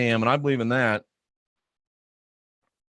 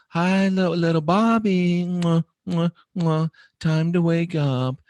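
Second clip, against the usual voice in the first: high, sweet cooing with air kisses; cooing parent; 4 semitones higher than usual. Retold or imitated. Imitating a gentle mother kissing a child awake, cooing. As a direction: coo like a doting mother. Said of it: "She says".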